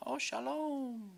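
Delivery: a drawn-out high sing-song shalom; playful greeting. Pretend. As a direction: stretch it slowly, up high, like a cheerful greeting. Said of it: "playful greeting aside".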